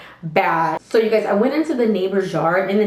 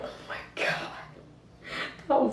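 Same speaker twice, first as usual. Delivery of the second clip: very slow, hushed whisper; whispered disbelief; 5 semitones higher, 13 dB quieter, much slower than usual. Acted. Looking back on the fight, stunned slow whisper. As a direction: whisper it slowly, as if appalled.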